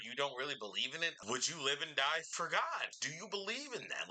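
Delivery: ordinary talk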